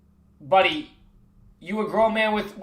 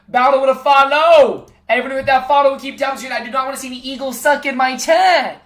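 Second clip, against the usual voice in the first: higher, louder rallying; hype call to chat; 5 semitones higher, 6 dB louder than usual. Real, not acted. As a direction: rally chat to keep tapping. Hyping chat to tap.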